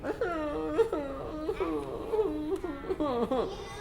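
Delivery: high hammed-up whimpering sob; mock crying. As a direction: play a crying worm. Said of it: "someone is crying".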